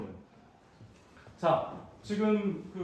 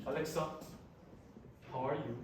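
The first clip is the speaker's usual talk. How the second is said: talking to voice assistant; 6 dB quieter, much slower than usual. Shown as a demonstration. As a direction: clear call to Alexa. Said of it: "아마존 것도". (알렉사에게 말하듯 또박또박)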